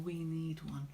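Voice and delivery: ordinary talk, nothing marked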